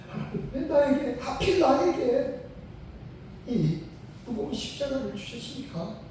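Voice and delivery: mock the grumbling plea to God, lightly; complaining prayer. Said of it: "하고 불평을 하는데".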